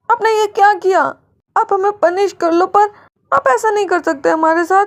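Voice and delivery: put-on high, anxious little girl begging forgiveness; a scared daughter begging her mother to be okay; frightened child pleading.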